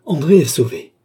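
high, loud exclamation of deliverance; relieved cry. A narrator's reading. André sees the pursuer flee, crying out in relief. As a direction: cry out as the danger lifts.

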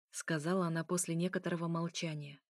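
ordinary delivery

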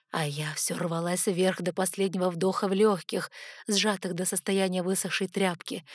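ordinary delivery, nothing marked

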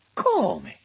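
high, loud incredulous cry; exclaimed. A narrator's reading. Auriti exclaims at the minister, taken aback. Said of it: "esclamò Lauriti".

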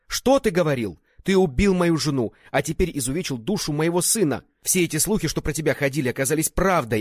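high, loud, anguished accusations; furious tirade. A narrator's reading. Widowed father confronting his trusted friend, furious tirade. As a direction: rage at a friend who betrayed you.

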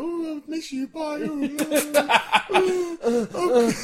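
high, needy farewell voice; mock clingy goodbye. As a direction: play a soppy clingy friend.

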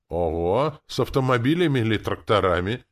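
loud, high, impressed questioning; persistent curiosity. A narrator's reading. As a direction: press on eagerly with the question. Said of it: "не унимался".

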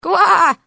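high, loud mock croak; frog croak.

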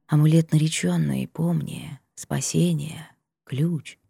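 barely audible fading whisper; faint whisper. Acted. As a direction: whisper it as if from far off. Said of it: "Шёпот ... еле уловимым, невнятным".

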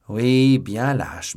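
plain reading voice

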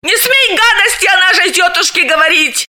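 high indignant shout. A narrator's reading. Galina defends old aunt Mara from her friend, indignant shout. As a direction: bristle at the insult. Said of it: "возмутилась Галина".

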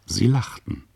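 usual speaking voice

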